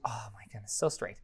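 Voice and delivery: whispered under the breath; hushed aside. Real, not acted. Coder to himself while working, hushed aside. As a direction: drop to a low, barely voiced murmur.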